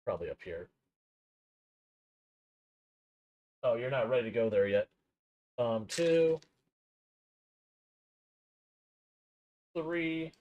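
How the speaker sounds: muffled, off-mic and distant; talking away from the mic. Real, not acted. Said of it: "I do apologize for it being a little bit muffled".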